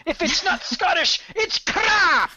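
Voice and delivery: put-on very high, loud Scottish-accented outburst; Mike Myers. Co-host quoting a comedy sketch, exaggerated Scottish accent. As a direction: shout it in a hammed-up Scottish accent. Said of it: "Myers from that Saturday Night Live sketch".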